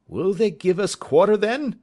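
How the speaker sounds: high, loud anxious question; calling back. A narrator's reading. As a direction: shout back hoping for mercy. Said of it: "says Tom Smith".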